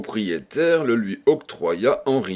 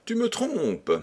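much higher, pressing wife voice; insisting wife; 13 semitones higher than usual. A narrator's reading. Wife pressing her husband for the truth, insistent. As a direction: catch him out, sharply suspicious. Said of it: "insista madame Bernard".

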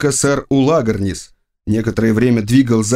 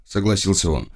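ordinary delivery, nothing marked

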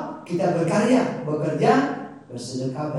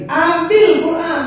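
loud urging; 10 semitones higher, 12 dB louder, slower than usual. Real, not acted. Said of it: "Kembali lagi".

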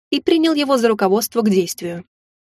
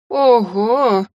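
изумлённый выдох. A narrator's reading. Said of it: "выдохнула я".

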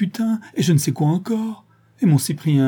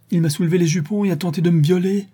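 mild cry; 7 semitones higher, faster than usual. Acted. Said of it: "cria Marie".

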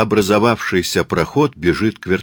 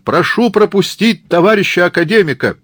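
high, loud official request; shout; 11 semitones higher, 5 dB louder than usual. Acted. Murat asks crowd to let academician through, shouting. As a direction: shout to clear a way. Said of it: "кричит Мурат".

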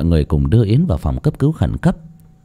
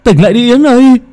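desperate call; 13 semitones higher, 8 dB louder than usual. Acted. Calling to unconscious Yến.

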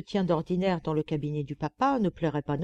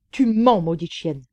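loud furious retort at the first words; angry outburst; 6 dB louder than usual. Acted. The clerk, stung by the letter, lashes out angrily. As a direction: burst out in indignant anger.

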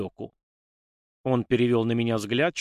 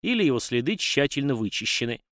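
plain reading voice

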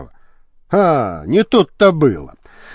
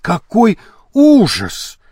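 high, drawn-out exclamation; dramatic cry; 5 semitones higher, slower than usual. A narrator's reading. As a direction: cry out in stagy horror, stretching the words. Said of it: "закричала Полидора". (протяжный вскрик ужаса)

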